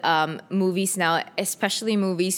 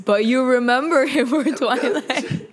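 laughing; 3 semitones higher, 4 dB louder than usual. Real, not acted. Teasing back, laughing.

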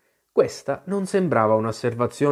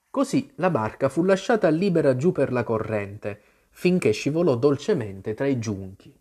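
ordinary delivery, nothing marked

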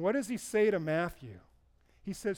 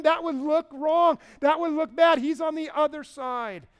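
higher, much louder anxious pleading; pleading protest; 7 semitones higher, 8 dB louder than usual. Acted. Crowd pleading with Jesus about his brand, louder and higher. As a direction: beg him to stop, rising panic.

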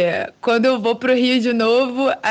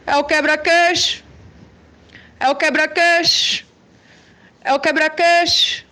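candy vendor cry; 5 semitones higher than usual. Retold or imitated. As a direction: call out like a candy seller. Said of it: "rola um cara do quebra-queixo".

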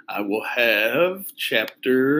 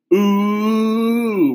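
teasing ooh; 9 semitones higher, 5 dB louder than usual. Acted. Friends teasing a couple.

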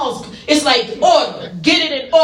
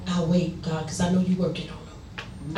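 quiet prayer aside; 7 semitones lower, 8 dB quieter than usual. Retold or imitated.

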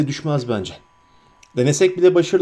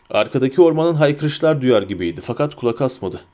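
ordinary delivery

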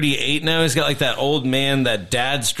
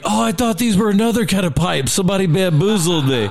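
higher, quick, put-upon dupe; whining investor tells how he got fooled; duped investor; 6 semitones higher, faster than usual. Retold or imitated. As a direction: play the fooled investor, rushed.

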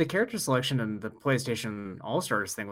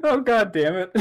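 laughing exclamation; 8 semitones higher, 7 dB louder than usual. Real, not acted. Laughing fit after a joke.

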